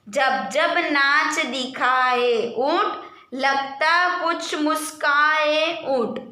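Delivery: slow sing-song poem reading; children's poem recital. Acted. Teacher reading a poem to pupils, slow sing-song. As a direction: recite it slowly like a nursery rhyme.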